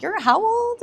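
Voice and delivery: jumps way up high in mock disbelief; a playful, amazed double take; incredulous. Reacting to a young VP.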